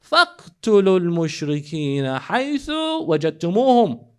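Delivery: intoned scripture recitation; Quran recitation. Acted. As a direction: chant the verse, rising in force.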